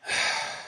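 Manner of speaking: long, soft exhale; weary sigh. Real, not acted. Player dreading tomorrow's tiring game, sighing. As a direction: sigh at what lies ahead.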